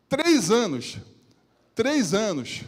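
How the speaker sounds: alto e agudo, martelando; ênfase pregada. Real, not acted. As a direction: bata na repetição com força. (pregador martelando os três anos, bem alto)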